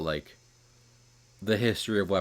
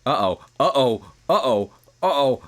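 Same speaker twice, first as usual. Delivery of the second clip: singsong uh-oh, louder each time; mock uh-oh; 3 semitones higher, 5 dB louder than usual. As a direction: tease a playful uh-oh. Repeated mock worry.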